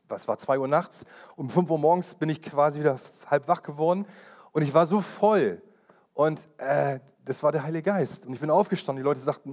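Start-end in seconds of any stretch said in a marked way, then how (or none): none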